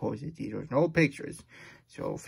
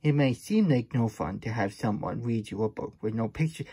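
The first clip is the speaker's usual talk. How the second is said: plain reading voice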